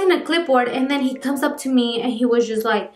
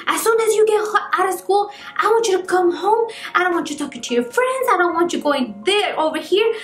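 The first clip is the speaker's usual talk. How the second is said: higher mock strict parent lecturing; imitating mom laying down the rules, pitched up; strict parent ordering the kids straight home, pitched up; 6 semitones higher than usual. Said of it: "Parents were so strict on us that they were like".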